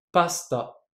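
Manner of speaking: pasta with the u dropped, only the s heard; devoiced vowel. Shown as a demonstration. Teacher shows the silent vowel in pasta, s kept bare. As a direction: say pasta letting the u vanish after the s. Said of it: "écoutez bien".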